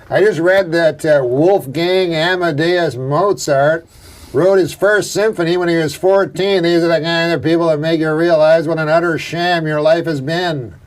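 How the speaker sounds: comic's rant voice, lifted a little; Miller impression. Comic retelling another comic's joke, in his voice. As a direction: imitate how he talks, stretching out key names and the punchline. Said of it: "that's how he talked".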